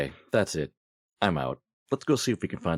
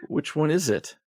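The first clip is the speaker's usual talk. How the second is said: high, raised-voice question; calling over noise; 10 semitones higher than usual. Acted. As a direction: shout the question over the racket.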